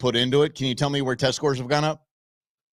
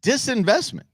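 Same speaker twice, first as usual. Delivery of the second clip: mocking repeat; 8 semitones higher than usual. Mocking the mayor's word.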